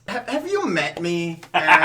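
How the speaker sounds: normal talking voice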